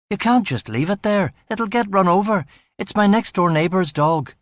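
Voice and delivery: pitched high and urgent, pleading; anxious pleading. A narrator's reading. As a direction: beg them not to leave the dog. Pleading with kidnappers over dog.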